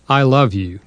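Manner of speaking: a gentle, sincere I love you; said with tenderness. Shown as a demonstration. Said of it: "when said with kindness and tenderness".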